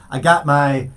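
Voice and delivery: mock Boston accent, dropped r's; lightly done Boston "khakis in my khakis". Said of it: "Kathleen taught me this one".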